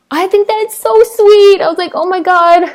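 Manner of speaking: high, melting "so sweet" gush; touched gushing. Real, not acted. Vlogger touched by a mom's sweet gift, high gushing. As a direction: gush in a high, heart-melted voice.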